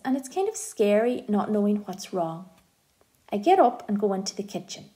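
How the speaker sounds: ordinary delivery, nothing marked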